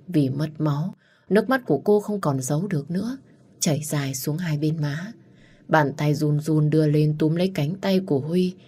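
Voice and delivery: ordinary delivery, nothing marked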